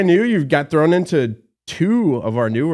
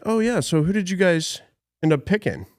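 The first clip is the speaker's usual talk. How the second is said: higher, casual fake-manager voice; posing as manager; 7 semitones higher than usual. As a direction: slyly play the manager fishing for info. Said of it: "I would act like I was the manager".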